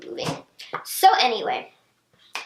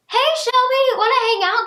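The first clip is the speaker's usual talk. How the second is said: high-pitched, chirpy re-enacted greeting; perky friendly invitation; 10 semitones higher, 4 dB louder than usual. Texting friend Shelby to hang out, perky invitation. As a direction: lift it high, like a casual invite.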